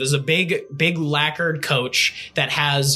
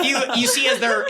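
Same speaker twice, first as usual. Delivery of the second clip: ordinary talk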